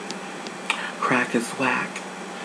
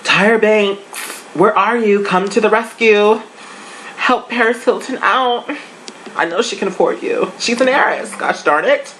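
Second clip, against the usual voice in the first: pleading call to Tyra; 9 semitones higher than usual. Retold or imitated. Vlogger begging Tyra Banks to fix Paris, high mock plea. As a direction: cry out to Tyra Banks for help, hammed up. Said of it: "I'm like".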